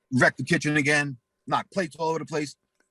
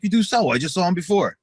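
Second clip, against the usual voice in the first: raised, pitched-up protest; insistent yell; 6 semitones higher, 5 dB louder than usual. Retold or imitated. To friend denying having pills, insistent raised yell. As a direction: push back hard and loud.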